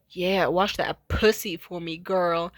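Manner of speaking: low, slow, drawn-out creep voice; masked peeping Tom. Retold or imitated. Masked intruder at the bath window, low creepy taunt. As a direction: leer it out low and loud. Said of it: "with their mask on and they just say".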